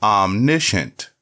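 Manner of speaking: "Omniscient" said slowly and clearly as AHM-NISH-ənt, falling at the end; slow clear dictionary pronunciation. Shown as a demonstration. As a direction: model reading of "Omniscient", stress on the first syllable. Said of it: "practice together".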